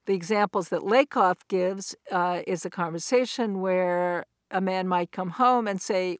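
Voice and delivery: normal talking voice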